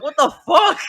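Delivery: loud, high-pitched what the fuck; exasperated outburst. Real, not acted. Ranting to friends about the battery dying, real outburst. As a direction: throw up your hands and yell it.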